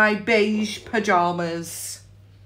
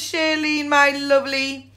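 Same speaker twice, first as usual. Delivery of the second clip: ordinary delivery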